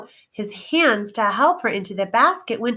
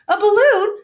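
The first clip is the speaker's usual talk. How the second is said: eager wizard; 13 semitones higher, 7 dB louder than usual. Acted. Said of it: "said Oz".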